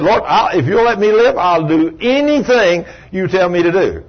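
high, urgent acted plea; imitated frantic promise; desperate bargaining. Dying man bargains for life.

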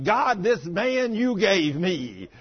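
loud, drawn-out complaint to God; mock fussing with slow emphasis; wife fussing. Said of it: "And she's fussing".

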